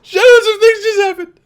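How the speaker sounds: high excited shout. Real, not acted. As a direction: call out urgently to a friend. Calling teammate in alarm.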